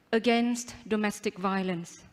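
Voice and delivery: ordinary talk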